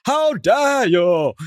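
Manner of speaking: slow, loud mock-pompous How dare you; Matt Berry outrage. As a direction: drag out the outrage like a grand theatrical ham. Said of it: "Do you know Matt Berry?".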